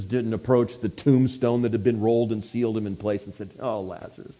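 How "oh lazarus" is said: mock-feeble, soft, sorry lament; hammed-up limp, apologetic pleading; weak wooing. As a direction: plead weakly, voice sunk low and soft. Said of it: "some weak kind of wooing".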